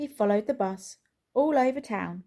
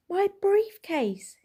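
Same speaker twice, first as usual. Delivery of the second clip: much higher, louder, slow panicked cry; high slow alarmed wail; 12 semitones higher, 6 dB louder, slower than usual. A narrator's reading. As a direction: gasp it out in slow dismay. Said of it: "he said".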